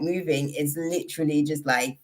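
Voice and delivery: normal talking voice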